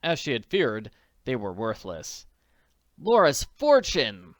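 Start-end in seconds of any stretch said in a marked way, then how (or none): 3.0-4.4 high, loud, slow angry cry. A narrator's reading. Nancy sees the empty safe, crying out angrily. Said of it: "she cried angrily".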